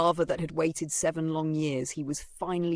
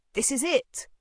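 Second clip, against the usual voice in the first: excited; 10 semitones higher, 4 dB louder than usual. Acted. Arrives at destination, exclaims.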